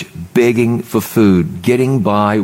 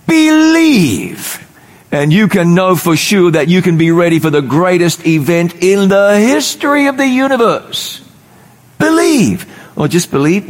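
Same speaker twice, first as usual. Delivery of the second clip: fiery preaching; 9 semitones higher than usual. Acted. Preacher rousing the congregation to take salvation, at full force.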